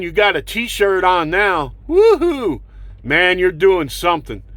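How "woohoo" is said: high sarcastic cheer, sneering; mocking woohoo. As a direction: fake celebration to mock their lifestyle. Mock cheer at t-shirt activism.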